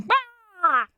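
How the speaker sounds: high, harsh mock bird squawk; imitating ibis scream. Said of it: "all they do is just scream".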